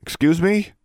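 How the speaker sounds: high, rising startled question; a wary, taken-aback query. A narrator's reading. Student to the custodian fetching her, startled and wary.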